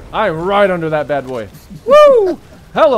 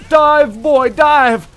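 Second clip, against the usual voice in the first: shouting; 6 semitones higher, 4 dB louder, much slower than usual. Real, not acted. Urging on in heated battle.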